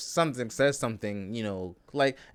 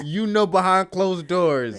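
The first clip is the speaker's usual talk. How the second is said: slow, loud teasing insinuation; loud slow tease; 8 semitones higher, 8 dB louder, slower than usual. Real, not acted. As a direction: lean in, drag it out, loud. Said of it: "loud slow tease".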